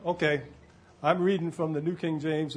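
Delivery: ordinary delivery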